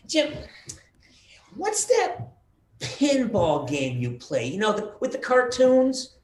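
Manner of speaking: high, confused mother voice; imitating mom. Son recalls mom's phone call, high puzzled mom voice. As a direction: play his mom, baffled by the game.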